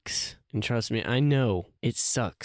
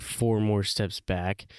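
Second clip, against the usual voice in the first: slow emphatic; slower than usual. Acted. Emphasizing setback.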